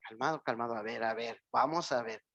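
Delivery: low and soft, like calming someone; calm soothing. Retold or imitated. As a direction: settle them down gently. Calming an upset person.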